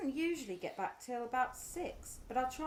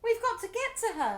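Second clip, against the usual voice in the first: louder and higher, pleading urgency; desperate urgency; 7 semitones higher, 6 dB louder than usual. Acted. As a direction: she needs to get to her hurt daughter. Mother desperate to reach daughter.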